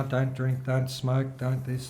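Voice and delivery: ordinary talk, nothing marked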